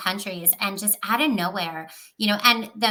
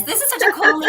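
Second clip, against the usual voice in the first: a bright, laughing burst of delight, pitched higher and louder; excited; 9 semitones higher, 6 dB louder, faster than usual. Retold or imitated. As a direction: gush over the cool name with a laugh. Retelling excited first reaction.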